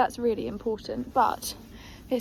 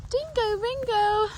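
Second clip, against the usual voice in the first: sing-song call; 10 semitones higher, 4 dB louder, much slower than usual. Real, not acted. Calling horse, sing-song.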